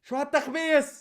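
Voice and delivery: high disbelieving cry; exasperated high question; incredulous. Real, not acted. Vlogger reacting to the clip, high incredulous cry.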